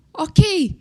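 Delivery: high, loud, bewildered okay; stunned acceptance. Retold or imitated. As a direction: agree in mock shock. Retells her stunned acceptance.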